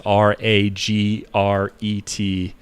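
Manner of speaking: slow deliberate spelling of R A G R E T as a joke; spelled out slowly. Pretend. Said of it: "No regrets".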